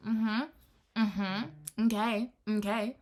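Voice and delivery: rising, unconvinced okays; sceptical mm-hmm. Retold or imitated. When he promises things, a sceptical mm-hmm and okays. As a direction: humor them with doubtful okays. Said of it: "I'll go".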